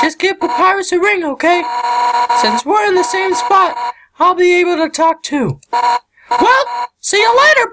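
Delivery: high-pitched tough fighter voice; high character voice. Acted. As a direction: voice a brash friendly fighter up high.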